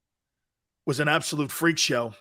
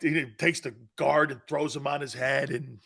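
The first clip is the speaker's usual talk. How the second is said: ordinary talk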